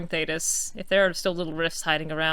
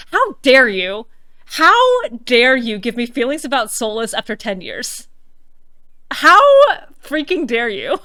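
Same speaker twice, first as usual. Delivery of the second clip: mock outrage; 2 semitones higher, 7 dB louder than usual. Mock outrage at game writer.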